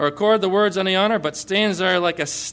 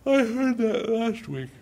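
bored dismissive listener; 4 semitones higher than usual. Retold or imitated. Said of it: "Or is it like".

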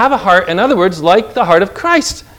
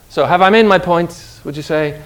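normal talking voice

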